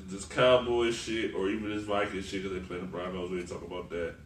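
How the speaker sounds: ordinary talk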